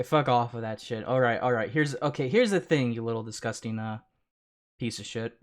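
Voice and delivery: ordinary delivery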